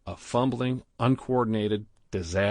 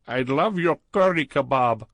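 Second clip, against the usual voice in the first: put-on higher, louder, heavy Indian accent; thick Indian accent; 5 semitones higher, 6 dB louder than usual. A narrator's reading. Clerk Badrul flirting with Stephen in the jacuzzi, thick Indian accent.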